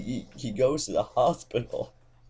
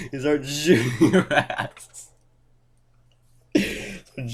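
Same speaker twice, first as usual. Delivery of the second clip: higher-pitched, laughing narration; words breaking into real laughter; 5 semitones higher, 4 dB louder, slower than usual. Real, not acted. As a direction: crack up while telling it. Cracking up at own story.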